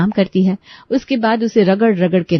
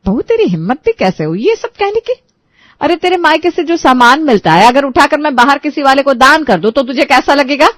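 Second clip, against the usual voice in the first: furious outburst; 6 semitones higher than usual. Acted. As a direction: let her temper boil over at the daughter-in-law. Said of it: "सास नीलिमा का पारा आसमान छूने लगता है". (गुस्से से भड़की सास की ऊँची, तेज़ फटकार)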